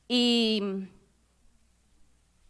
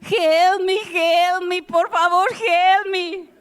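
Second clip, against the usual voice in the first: high, loud, pleading shout; frantic loud plea; desperate cry for help; 9 semitones higher, 8 dB louder, much slower than usual. Retold or imitated. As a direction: beg a stranger to help you, voice cracking high.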